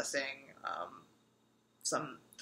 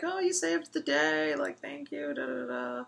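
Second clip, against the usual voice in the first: imitating the kids; 9 semitones higher, 3 dB quieter than usual. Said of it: "the kids were like".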